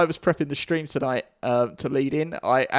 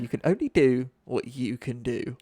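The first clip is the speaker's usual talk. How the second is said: lightly mimicking low, measured advice; mock-sage, subdued delivery; quoted workplace platitude; 5 semitones lower, 4 dB quieter than usual. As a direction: repeat it lower, like a stock saying. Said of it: "every day I hear it".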